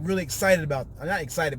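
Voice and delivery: ordinary talk, nothing marked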